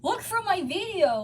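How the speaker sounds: ordinary delivery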